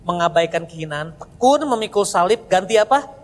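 ordinary delivery

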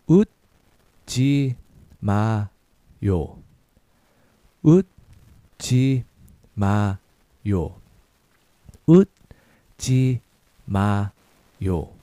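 slow, 웃지 drawn out; slow syllable drill. Shown as a demonstration. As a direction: model it slowly for repetition. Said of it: "A little faster".

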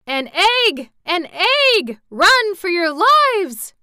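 high, loud, horrified cry; voice of horror. A narrator's reading. Gnome captain fleeing the egg, horrified shout. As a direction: shriek the warning in terror. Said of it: "in a voice of horror".

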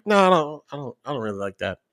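soft, trailing-off demurral; picky kid quietly backing away; retold meek refusal. Imitating picky kid declining everything.

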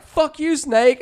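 mock yell. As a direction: fling the curse like a kid. Brother yeeting the snake.

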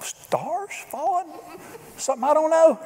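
mock puzzled squeak at the claim; incredulous. Preacher hearing the stars-falling vow, high incredulous mock.

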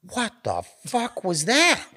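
high, slow, amazed what the fuck; retold shocked wonder; stunned disbelief. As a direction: drag it out, bewildered. Coming down from the trip.

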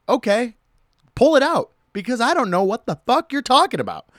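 high, loud exasperated snap; fed up with the agent, pushing back loudly; exasperated retort. Retold or imitated. Answering the TSA agent, exasperated and loud.

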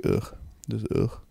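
breathy fed-up groan; exasperated ugh. Real, not acted. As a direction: sigh it out, fed up. Groaning about dull topics.

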